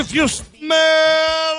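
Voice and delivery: loud, high, hugely drawn-out catchphrase shout; The Rock. Acted. Caller answering who the guest host is, shouted wrestler impression. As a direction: bellow the catchphrase, stretching the last word. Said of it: "The Rock".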